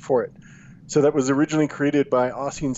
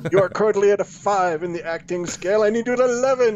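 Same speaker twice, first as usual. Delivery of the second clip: mock director; 6 semitones higher than usual.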